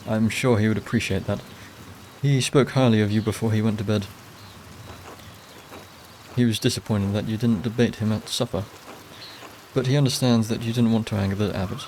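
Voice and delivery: usual speaking voice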